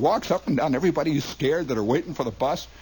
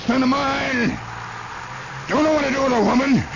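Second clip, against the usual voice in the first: put-on high hollering old man driving a mule; bossy old father; 11 semitones higher than usual. Father scolding his son while driving a mule, high hollering. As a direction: holler like a tough old patriarch.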